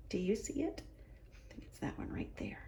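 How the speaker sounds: hushed, breathy whisper. Real, not acted. Host whispers to the children, pointing out the tooth. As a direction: lean in and whisper the secret. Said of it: "showing the children the tooth".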